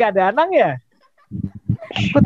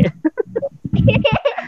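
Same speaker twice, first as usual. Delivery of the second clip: high puppet giggle. Acted.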